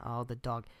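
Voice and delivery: hushed whisper at the dog. Acted. Player whispering as he spots the dog, hushed. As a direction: breathe it out under your breath.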